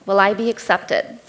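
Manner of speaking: ordinary delivery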